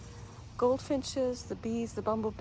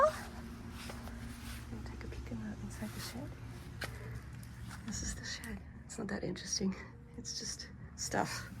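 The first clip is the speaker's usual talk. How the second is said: whispering; 11 dB quieter than usual. Real, not acted. Peeking inside quietly.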